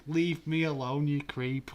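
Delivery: high, loud mocking woman's voice; hammed-up disgusted girl. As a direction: mimic a girl shoving off a creep. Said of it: "them fifty women are saying".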